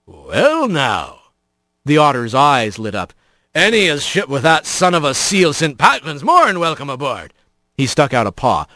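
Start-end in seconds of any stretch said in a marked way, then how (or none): 0.1-1.2 otter sea captain. Acted. 3.5-7.3 put-on an old sea dog's salty welcome; otter sea captain. Otter captain welcoming Rat aboard, salty sea dog voice.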